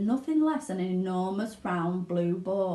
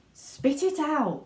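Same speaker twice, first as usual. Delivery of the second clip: slow, higher urgent order; Mr Wonka; 7 semitones higher, slower than usual. Acted. Wonka urges Violet to spit the gum, slowly. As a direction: drag it out, worried. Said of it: "said Mister Wonka".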